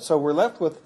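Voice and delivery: ordinary talk, nothing marked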